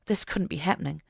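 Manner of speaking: ordinary delivery, nothing marked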